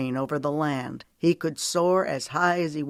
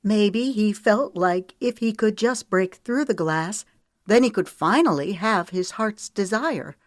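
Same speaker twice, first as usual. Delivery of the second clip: higher, emotional heroine plea; pleading, pitched up, with a touch of upset; hitching voice; 6 semitones higher, 4 dB louder than usual. A narrator's reading. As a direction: press on through rising emotion. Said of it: "Her voice hitched".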